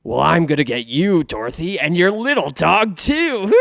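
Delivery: witch voice rising to a high, loud cry on the final word; Wicked Witch impression. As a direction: build the witch threat up to a shriek. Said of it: "that was pretty good".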